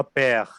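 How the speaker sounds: पैर said as French père with a long open è; French word père. Shown as a demonstration. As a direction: read पैर the French way, stretching the è. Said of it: "तो मैं इसको पढ़ूंगा". (French उच्चारण में पैर, लंबा खुला ऐ)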